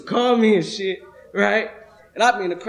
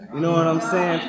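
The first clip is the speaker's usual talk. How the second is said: ordinary delivery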